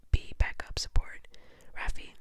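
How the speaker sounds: plain reading voice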